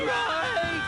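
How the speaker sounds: panicked yell to run; terrified shout of run. Acted. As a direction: scream it as you flee. Fleeing the ghost clown.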